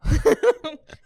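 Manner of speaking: short, loud, high-pitched laugh; amused high chuckle at a deadpan answer. Real, not acted. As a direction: burst out in a quick laugh. Host laughs at guest's denial.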